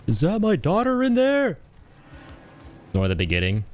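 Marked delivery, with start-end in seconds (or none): 0.0-1.6 high, hammed-up anguished cry; movie quote. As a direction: wail it like a desperate film father. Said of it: "would fucking love quoting the beginning of that film".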